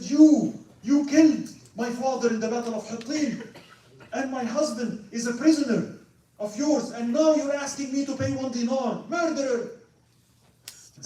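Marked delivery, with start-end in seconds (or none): 0.0-9.8 high, loud grieving anger; angry accusation. Retold or imitated. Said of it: "started yelling at Salah ad-Din".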